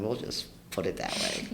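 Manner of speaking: soft unvoiced coy aside; hushed, knowing whisper. Real, not acted. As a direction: whisper it with a wink in the voice. Coy aside about fun.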